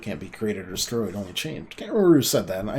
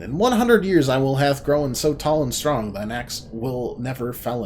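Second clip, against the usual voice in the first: loud, raised gloating vow; triumphant boast; 4 semitones higher, 7 dB louder than usual. Acted. Escaping tree brother gloats at the Cutter, loud and triumphant. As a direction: crow over the woodcutter.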